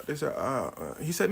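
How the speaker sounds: ordinary delivery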